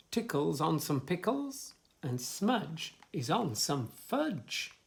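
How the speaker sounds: sing-song rhyming list; reading to children. Acted. Reader lists rhymes for a child, sing-song. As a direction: bounce through the rhymes for little ones.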